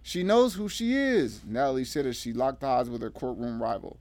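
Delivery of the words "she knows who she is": high, slow, emphatic statement; pointed challenge. A narrator's reading. Natalie facing down her courtroom rival, pointed. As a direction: say it pointedly to an opponent.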